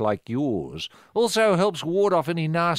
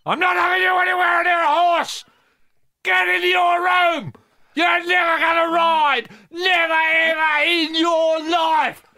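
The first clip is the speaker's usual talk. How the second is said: much higher, louder furious shouting; angry parent yelling; 4 dB louder than usual. Acted. Mother forbidding Michelle to go near horses, furious shout. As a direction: scream the child to her room.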